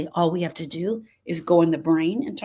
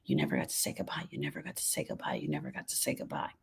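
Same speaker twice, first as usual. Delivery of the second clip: fast, lower whisper repeating like a nagging thought; whispered inner voice; 14 dB quieter, faster than usual. Acted. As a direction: hiss it out quickly like the brain looping. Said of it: "your brain will spin on that thought".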